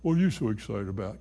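mock the doubters with a quick low jab; mocking skeptic. Preacher imitating doubters who mock excitement. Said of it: "others say".

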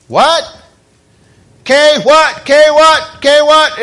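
high, mock-confused back-and-forth; rapid-fire puzzled questions pitched high; mutual bafflement. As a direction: imitate two foreigners puzzling at each other. Two foreigners baffled at each other.

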